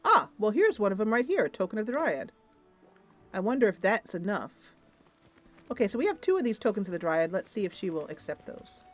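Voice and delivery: normal talking voice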